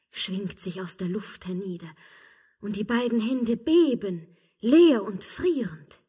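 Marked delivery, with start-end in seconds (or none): 2.6-6.0 much louder, ringing outburst; cry out. Acted. Witch crying out at the emperor about his empty hands. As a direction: hurl the words at him.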